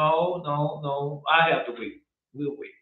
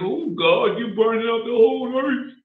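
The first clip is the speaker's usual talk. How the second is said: mock crying prayer; 8 semitones higher than usual. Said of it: "You think I'm gonna cry and tears?".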